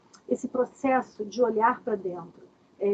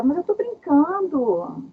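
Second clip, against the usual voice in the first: drawn-out, higher-pitched mock protest; joking excuse; 5 semitones higher, 4 dB louder, slower than usual. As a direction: stretch it out, playing down the offence. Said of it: "Aí a pessoa fala".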